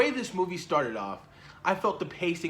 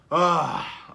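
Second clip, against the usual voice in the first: ordinary delivery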